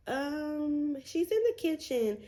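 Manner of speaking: high, breezy cover-up voice; fake innocent. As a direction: sound casual while lying to mom. Covering for hidden boyfriend on phone.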